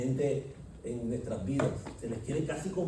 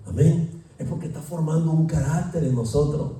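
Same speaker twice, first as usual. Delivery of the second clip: louder preaching at full force; lightly raised sermon voice; fervent preaching; 6 dB louder than usual. Acted. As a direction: preach it to the congregation.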